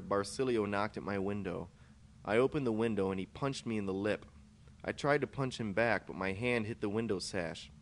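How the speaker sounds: plain reading voice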